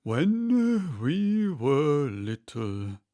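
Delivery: a touch of a sob as it starts; mildly sobbing. Acted. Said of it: "more calmly, though still sobbing".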